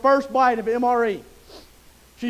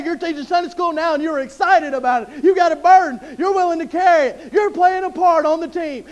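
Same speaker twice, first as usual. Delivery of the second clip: ordinary talk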